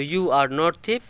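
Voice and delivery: very slow, word by word; slow example. Shown as a demonstration. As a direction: spell out the example slowly. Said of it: "समझ में आ गई ये बात?". (बहुत धीरे उदाहरण बोलना)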